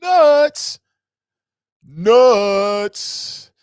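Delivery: loud, high punch on nuts; loud exasperated nuts; emphatic nuts. Real, not acted. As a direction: slam the word home. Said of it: "they will drive you nuts".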